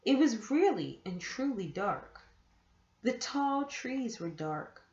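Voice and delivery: plain reading voice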